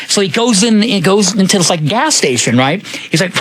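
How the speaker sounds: ordinary talk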